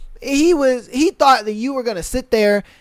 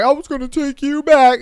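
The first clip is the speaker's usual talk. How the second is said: mocking the ex; 5 semitones higher, 4 dB louder than usual. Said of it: "he's just like".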